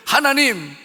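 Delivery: loud opening call of prayer; loud plea; crying out to God. Acted. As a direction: cry out to God. Sinner cries out in prayer.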